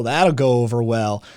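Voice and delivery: ordinary talk, nothing marked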